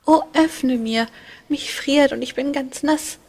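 put-on high, loud, pleading child's cry through the door; small child calling. A narrator's reading. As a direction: call out high like a freezing little boy. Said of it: "rief draußen ein kleines Kind". (hohe, laute, flehende Kinderstimme)

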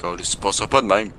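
switching impression voices. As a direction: grab the phone like the father. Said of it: "la meilleure imitation de Caillou".

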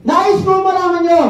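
booming high announcement; royal proclamation. Retold or imitated. King's best friend addressing the kingdom, booming call. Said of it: "titawag sa kingdom".

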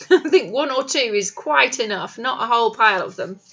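usual speaking voice

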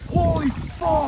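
high, slow, stunned yell; excited shout. Real, not acted. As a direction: shout it in real disbelief. Big fish just caught.

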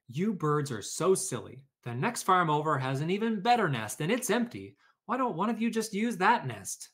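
a hint of an exasperated know-it-all; the farm helper talking the silly birds down; Jack scolding the birds. A narrator's reading.

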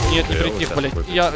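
ordinary talk

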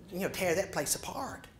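ordinary delivery, nothing marked

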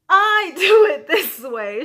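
loud, slow, laughing through the words. Retold or imitated. Showing a friend her own knitting way, loud with laughter. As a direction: giggle while defending your method.

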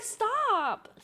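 high mock plea to stop; whiny protest. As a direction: beg the teasing friends in a high voice. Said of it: "And I'm like".

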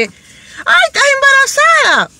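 high squealy mock exclaim; gushing busybody. Tactless woman squealing at a stranger.

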